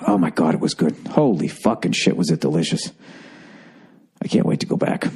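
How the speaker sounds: groaning with a full stomach; stuffed full. Real, not acted. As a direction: talk like you just overate. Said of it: "got a whole giant burger in me".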